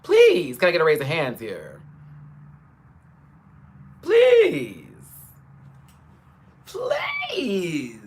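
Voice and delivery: rising, high dramatic please; pleading. Real, not acted. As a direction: beg the audience theatrically. Begging viewers for raised hands.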